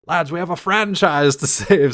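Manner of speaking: rally the lads with gusto; rousing captain. Retold or imitated. Captain won over by sequels, hearty rally call. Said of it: "the captain's like".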